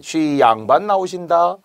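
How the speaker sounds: high, slow, drawn-out call; herald's announcement. Acted. Mask-dance servant announces the nobleman to the crowd, calling out. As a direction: stretch it out like a performer announcing an entrance.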